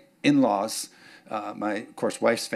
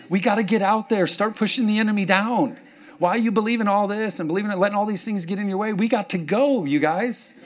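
higher, driving exhortation; preaching at full force; 8 semitones higher than usual. Acted. Pastor urges the church to serve, driving and raised. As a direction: push the church to act. Rallying congregation.

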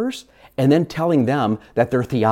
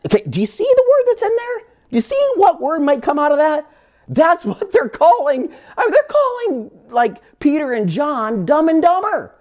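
mock incredulous; 13 semitones higher than usual. Mocking "dumb and dumber" gag.

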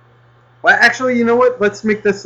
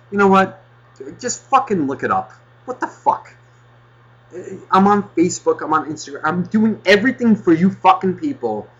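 exasperated outburst; angry rant; 6 semitones higher than usual. Real, not acted. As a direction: vent at the listeners in mock fury. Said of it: "I don't know why I'm getting angry at you".

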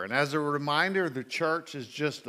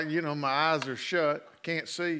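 ordinary talk

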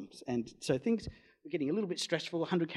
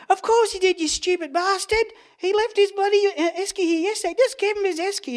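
much higher, louder hammed-up scolding; mock scolding wife; 9 dB louder than usual. Wife scolding her husband over the esky, shrill mock telling-off. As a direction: scold him like a fed-up wife.

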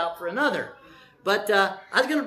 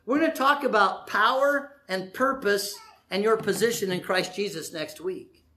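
ordinary talk

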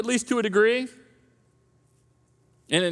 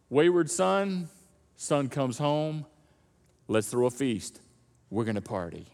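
slower, lower, fading away; a hushed, slow, lower wind-down; softening; 8 semitones lower, 5 dB quieter than usual. Acted. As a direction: let it drop soft and slow. Rhetorical build in preaching.

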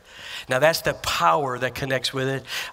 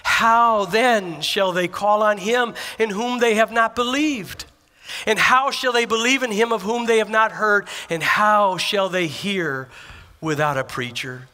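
slow, louder, lifted preaching read of the verse; scripture recitation; 4 semitones higher, 4 dB louder, slower than usual. Acted. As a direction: proclaim the scripture with rising force. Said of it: "The scripture will say it this way".

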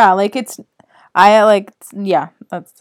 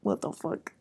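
breathy whisper of shock under the breath; whispered thought; 5 semitones lower, 16 dB quieter than usual. Retold or imitated. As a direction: whisper it as a private reaction. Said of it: "And I remember thinking".

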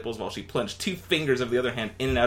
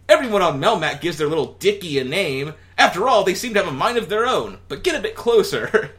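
high, louder alien Alf; 7 semitones higher, 7 dB louder than usual. Acted. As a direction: explain Melmac proudly. Alf voiced.

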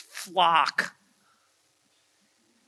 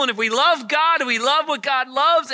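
louder and higher, driving the refrain home; rising preaching emphasis; 7 semitones higher, 4 dB louder than usual. Real, not acted. Preacher pressing the point on his congregation with force. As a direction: build it up like a sermon climax.

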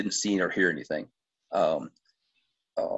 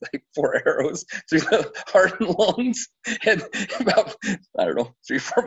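laughing through words; 10 semitones higher than usual. Real, not acted. Laughing while telling the kill.

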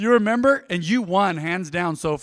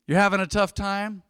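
ordinary delivery

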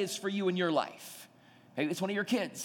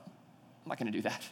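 quiet denial; 9 semitones lower, 8 dB quieter than usual. Retold or imitated. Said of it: "And Peter goes".

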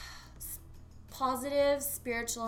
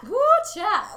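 high, loud playful ooh cha; squealed catchphrase; 11 semitones higher, 13 dB louder than usual. Pretend. Friend squealing the catchphrase back. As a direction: squeal it out with glee.

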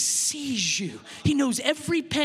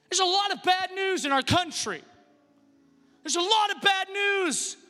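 raised, driving preacher voice; forceful preaching; 7 semitones higher than usual. Real, not acted. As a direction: press it home to the congregation.

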